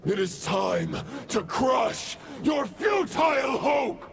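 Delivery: high, rising war cry; battle cry. Acted. Roland crushing Varka's hope, rising battle cry. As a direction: build to a roar on hope.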